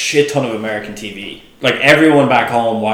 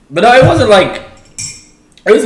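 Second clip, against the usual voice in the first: words bubbling through real laughter, higher and louder; laughing; 14 semitones higher, 7 dB louder than usual. Real, not acted. Guest recovering from a joke, laughing through words. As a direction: still giggling while trying to answer.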